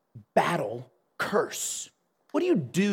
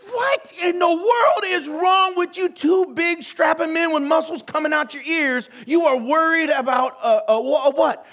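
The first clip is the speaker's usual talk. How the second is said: incredulous outburst; 10 semitones higher, 5 dB louder than usual. Retold or imitated. Berating two big men afraid of ghosts, incredulous. Retold outburst at scared officers.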